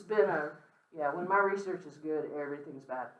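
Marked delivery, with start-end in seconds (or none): none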